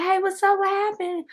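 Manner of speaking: high squeaky guy voice; high-pitched voice. Retold or imitated. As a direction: mimic his high voice. His high voice imitated.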